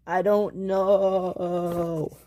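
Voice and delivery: very slow, drawn-out wail; plaintive, dragged-out answer; helpless. Acted. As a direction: stretch the last word forlornly. Stranded engine is helpless.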